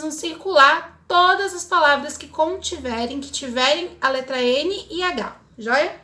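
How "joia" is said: ordinary talk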